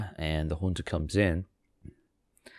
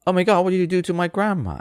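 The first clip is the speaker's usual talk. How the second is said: much higher, louder and fast, in mock panic; panicked little girl; 14 semitones higher, 6 dB louder, faster than usual. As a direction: shriek it like a terrified kid finding grandma gone. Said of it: "So she was like".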